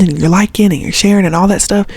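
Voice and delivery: usual speaking voice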